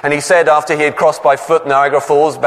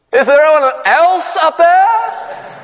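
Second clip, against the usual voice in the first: much higher, slow, laughing shout; shouted again; 13 semitones higher, slower than usual. Retold or imitated. Man shouts for a better answer, high, slow and laughing. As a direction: yell it up, laughing at the punchline. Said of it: "the man shouted again".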